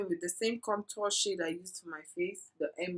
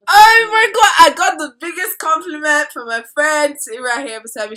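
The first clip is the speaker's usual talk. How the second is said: very loud, high burst, easing slightly; excited outburst; 8 semitones higher, 19 dB louder than usual. Real, not acted. Vlogger announcing a friend's compliment, loudly excited. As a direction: burst out loudly with thrilling news.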